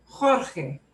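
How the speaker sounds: Jorge as "KHOR-kheh"; two jota sounds. Shown as a demonstration. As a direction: say Jorge with two throaty jotas. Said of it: "two j sounds in this name".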